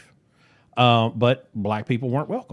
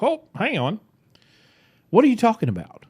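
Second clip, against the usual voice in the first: much higher, taken aback; incredulous retort; 10 semitones higher than usual. Retold or imitated. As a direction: pitch it up like you can't believe it. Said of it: "And I'm like".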